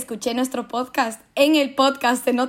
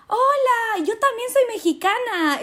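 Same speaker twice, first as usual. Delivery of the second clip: a high, chirpy stranger's voice, lightly put on; imitating a stranger; 11 semitones higher than usual. Retelling a stranger in Zara, high chirpy mimicry. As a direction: play the eager girl from the store. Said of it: "me dice".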